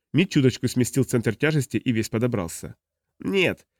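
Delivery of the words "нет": heated. Acted. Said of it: "жаром погромче отозвался фанатик".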